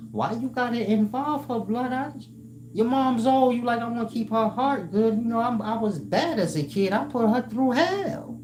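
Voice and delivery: high, earnest mock plea; pleading friend. Begging a friend not to tell his mom, mock high plea. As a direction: beg him to leave your mother out of it.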